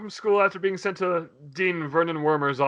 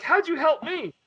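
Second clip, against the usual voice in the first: put-on kid voice; 9 semitones higher than usual. Kid asks creature.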